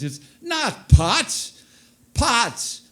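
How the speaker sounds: hammed-up Polish grandpa yelling; exasperated grandpa shout. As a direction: drag out "auto pots" in frustration. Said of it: "He always got excited".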